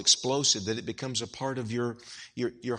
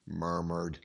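low, soft murmur; 9 semitones lower, 8 dB quieter than usual. Shown as a demonstration. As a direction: say it under your breath, low. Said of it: "Just listen to it. It explains itself".

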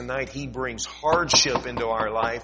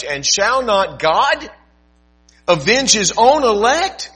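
loud, rising fervent scripture reading; preaching; 10 semitones higher, 12 dB louder than usual. Acted. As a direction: proclaim the verse from the pulpit. Preaching scripture at full force.

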